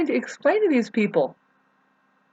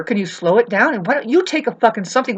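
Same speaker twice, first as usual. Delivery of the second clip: ordinary delivery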